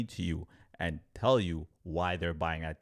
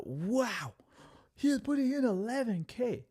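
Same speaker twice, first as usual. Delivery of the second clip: high, amazed mock gasp of a broke kid; awed. To viewers, recalling his awe at the friend's trade, amazed and high. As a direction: gasp at the big number like a wide-eyed kid.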